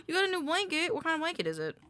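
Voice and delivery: surprised. Real, not acted. Surprised at new blanket.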